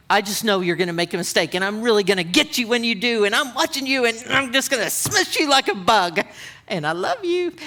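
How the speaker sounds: hurried, building mock threat; gleefully threatening, hammed up; mock menacing. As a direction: play a God out to get them, rising in pitch. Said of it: "No, it's not, it's not like that".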